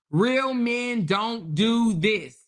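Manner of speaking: Real stretched slow and heavy; slow deliberate stress on Real; emphatic, slow. Real, not acted. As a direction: lean hard on Real, slowly. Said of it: "emphatic point".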